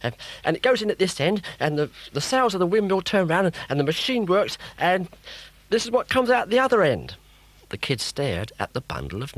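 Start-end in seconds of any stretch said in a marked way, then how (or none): 0.4-7.1 high, fast, eager explaining; excited. Acted. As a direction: rush through the explanation. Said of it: "so excited".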